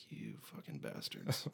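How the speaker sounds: very low, slow, hushed curse; mock grudge under breath. Musician meeting Canadians in Nashville, low resentful mock curse. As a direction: curse them slowly under your breath.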